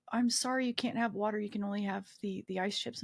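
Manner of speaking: ordinary talk, nothing marked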